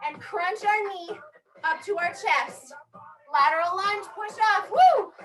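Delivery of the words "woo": loud, high whoop; cheer. Real, not acted. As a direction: whoop with energy. Coach cheering class mid-workout.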